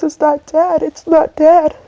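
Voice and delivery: high, distressed quote of bad news; retold anguish, pitch climbing into tears; tearful retelling. Speaker relives uncle's phone call about the death, tearful.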